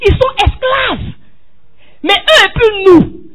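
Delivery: high, loud, slow preacher's cry; fervent pulpit cry; preaching shout. Acted. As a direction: hammer the point home to the church. Preacher cries out point.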